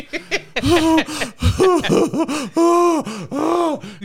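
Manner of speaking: loud, high exaggerated howls; mock broken-man wail. Imitating a beaten dad in the studio, mock wailing. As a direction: wail in hammed-up despair. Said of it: "you see a shattered, beaten man".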